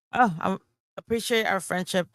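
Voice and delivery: ordinary talk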